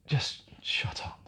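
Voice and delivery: whispered slowly, as the mate did; retold whisper. Guest retelling his mate ordering him to shut up, whispered. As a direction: hush him, low and firm.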